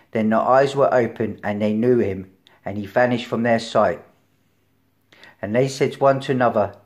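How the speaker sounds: loud, declaiming Bible reading; reading scripture out to the church. Acted. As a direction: proclaim the verses to the hall. Reads scripture aloud to congregation.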